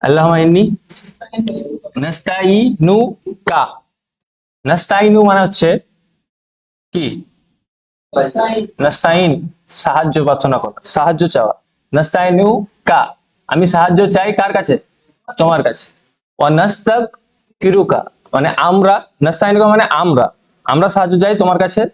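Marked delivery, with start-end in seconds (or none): 2.0-3.6 নাস্তাইনুকা spelled out slowly, syllable by syllable; slow recitation. Shown as a demonstration. Teacher recites dua word. 11.9-13.0 নাস্তাইনুকা spelled out slowly, syllable by syllable; slow recitation. Shown as a demonstration. Teacher recites dua word. 16.4-18.0 ওয়া নাস্তাগফিরুকা drawn out, falling; slow recitation. Shown as a demonstration. Teacher recites dua word.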